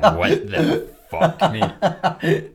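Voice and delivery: high, slow, laughing disbelief; laughing shock. Retold or imitated. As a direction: gasp it out through laughter. Shocked boss quoted, laughing.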